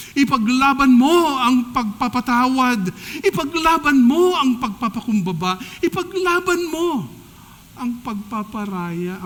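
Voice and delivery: loud, high rallying exhortation; preaching at full force. Real, not acted. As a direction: urge the church to stand and fight.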